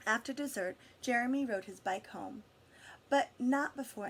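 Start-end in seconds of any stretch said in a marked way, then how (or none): none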